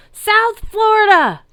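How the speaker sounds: very high loud drawn-out holler; stretched high loud team cheer; chapter yell. A narrator's reading. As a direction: shout your chapter's name with pride. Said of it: "a centaur yelled".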